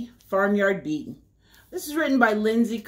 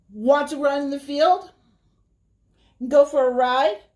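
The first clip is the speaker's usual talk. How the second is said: horse voice; 4 semitones higher, 4 dB louder than usual. Acted. Horse invites the spider.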